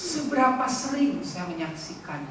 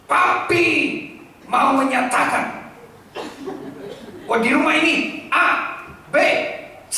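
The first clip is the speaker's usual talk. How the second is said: pompous father; 6 semitones higher, 7 dB louder than usual. Retold or imitated. Father announcing house rules A B C, mock pompous. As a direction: announce your rules grandly. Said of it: "kalau saudara bilang".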